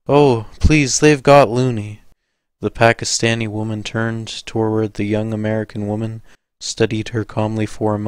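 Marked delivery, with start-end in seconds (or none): 0.1-2.0 loud, higher, panicked pleading; hysterical plea. A narrator's reading. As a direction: beg in a near-hysterical cry. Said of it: "semi-hysterical voice".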